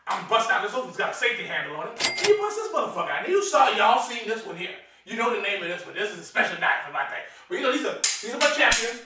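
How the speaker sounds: ordinary talk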